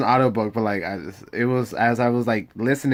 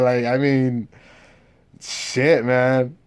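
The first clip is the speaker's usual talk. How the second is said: ordinary delivery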